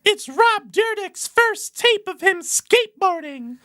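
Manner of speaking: put-on high-pitched girly voice; squeaky high girl.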